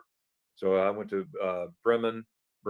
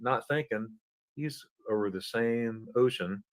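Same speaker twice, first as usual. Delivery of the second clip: normal talking voice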